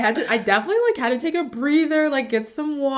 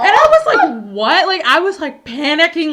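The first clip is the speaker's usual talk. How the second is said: panicked; 5 semitones higher, 8 dB louder than usual. Retold or imitated. Recalls panic, loud.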